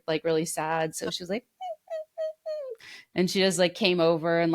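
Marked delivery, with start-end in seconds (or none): 1.6-2.7 a high, whimpering little squeak; mock puppy whimper; imitated puppy squeak. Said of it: "squeaking like a little puppy dog".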